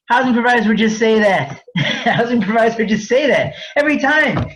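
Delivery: high, laughing through the words; amused disbelief. Real, not acted. Speaker scoffing at providers' excuse, laughing. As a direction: laugh at how predictable it is.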